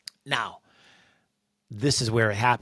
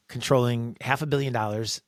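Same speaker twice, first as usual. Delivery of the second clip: usual speaking voice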